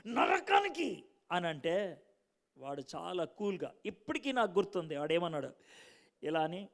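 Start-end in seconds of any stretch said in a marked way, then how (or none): 0.1-1.0 sharp, high mock-angry snap; retold angry shout to hell; angry retort. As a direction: fire back in a retold temper. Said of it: "మస్తు కోపంతో".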